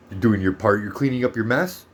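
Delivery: ordinary talk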